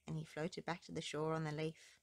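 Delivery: ordinary delivery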